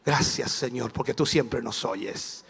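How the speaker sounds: low, soft prayer of thanks; imitated quiet, assured believer praying; calm prayer. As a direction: pray it evenly, voice dropped. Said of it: "levantas tu mano y dices".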